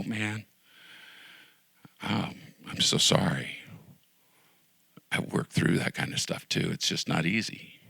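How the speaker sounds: soft, near-whispered comforting words; gentle hushed sympathy. Pretend. A friend consoling a depressed believer, soft whisper. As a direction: whisper it like a friend who understands. Imagined kind friend's reply.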